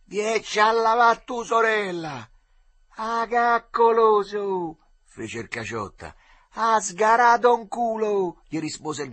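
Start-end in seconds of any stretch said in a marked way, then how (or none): 0.1-2.3 loud, high, stretched-out holler; shouted retort. A narrator's reading. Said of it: "gli rispose gridando dall'altra riva". 3.0-4.8 high, loud, drawn-out taunt; yelled insult. A narrator's reading. Insults yelled across the river. 6.5-8.4 loud, high, drawn-out insult; yelled comeback. A narrator's reading. Insults yelled across the river.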